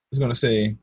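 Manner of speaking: usual speaking voice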